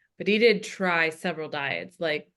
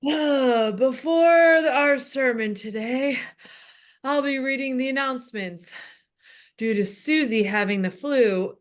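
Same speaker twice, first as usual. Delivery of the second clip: put-on old pastor, higher and louder, wheezing; older heavy-breathing man; 5 semitones higher, 4 dB louder than usual. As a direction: puff through it like a heavy old preacher. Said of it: "The voice of an older, heavy-breathing man".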